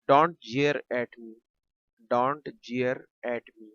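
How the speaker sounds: crawl through each word for learners; stretched-out teacher demo; slow, careful model phrase; very slow dictation. Shown as a demonstration. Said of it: "teacher models English sentence".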